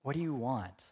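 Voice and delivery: a soft, patient question from Jesus. Retold or imitated. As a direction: ask gently and quietly. Jesus voiced asking softly.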